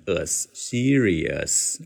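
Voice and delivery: very slow serious ending in a clear "us". Shown as a demonstration. Teacher shows the slow serious that keeps the us. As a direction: drag serious out slowly, ending on "us". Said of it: "读得非常慢的时候就不能读成".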